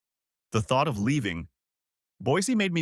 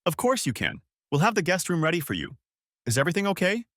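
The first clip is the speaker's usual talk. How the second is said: higher, lightly voiced worried sister; concerned sister; 7 semitones higher than usual. A narrator's reading. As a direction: sound suddenly worried for her. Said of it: "Her tone instantly turned concerned".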